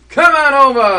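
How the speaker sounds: loud high hawking cry; barker's call. Acted. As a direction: call the crowd over. Barker calls out again.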